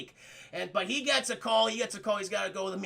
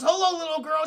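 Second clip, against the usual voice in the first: friendly greeting; 6 semitones higher, 6 dB louder than usual. Retold or imitated. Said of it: "And he goes".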